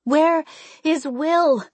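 high, loud, slow desperate call; crying out. Acted. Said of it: "I cry out".

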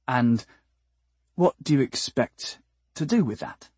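mildly nervous, halting question. A narrator's reading. Decibel asks about the hairbrush, mildly nervous. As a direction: ask warily, pausing after the first word. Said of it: "Decibel asked nervously".

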